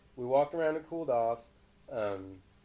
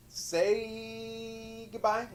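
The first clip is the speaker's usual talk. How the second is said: drawn-out goodbye; 7 semitones higher, much slower than usual. Real, not acted. Parent signing off video.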